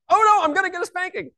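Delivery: mock a little boy melting down; whiny child. Son answering his parent's warning, whiny child voice. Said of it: "He's just saying".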